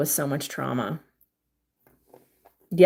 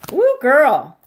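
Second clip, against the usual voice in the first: high, loud whoop of delight; squealing woo for a fellow fan; excited cheer; 8 semitones higher, 8 dB louder than usual. Real, not acted. Cheering for a Real Housewives fan.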